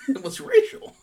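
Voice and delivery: high squeal of laughter through the words; laughing hard while saying it. Real, not acted.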